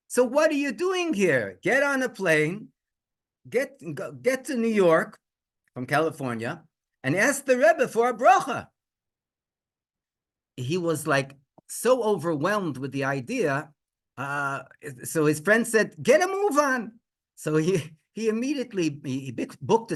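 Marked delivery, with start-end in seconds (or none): 0.1-8.7 high-pitched, emphatic push to act; insistent urging. Retold or imitated. Friend sending the father to the Rebbe, insistent. 16.0-17.0 high-pitched, emphatic hurry-up; urgent prodding. Retold or imitated. Friend hurrying the father to the plane, urgent.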